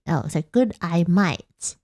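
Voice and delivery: normal talking voice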